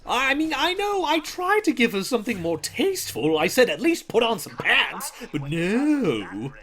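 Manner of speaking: high, loud cartoon villain voice; Joker. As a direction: ham it up as a giggly supervillain. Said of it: "I don't know, Joker".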